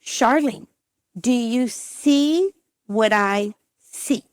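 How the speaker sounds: slow, drawn-out leading question; pointed doctor. Retold or imitated. Doctor prompting patient at the screen, pointedly slow. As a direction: stretch each word, pausing before the last.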